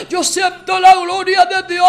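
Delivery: normal talking voice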